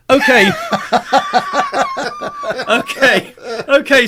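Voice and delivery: "Okay" squeaked out through laughter, then recovered; giggling through the bacon sandwich's okays; laughing okays. Acted. Bacon sandwich answers the barman, corpsing with laughter. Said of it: "says the bacon sandwich".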